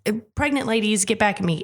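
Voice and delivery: ordinary delivery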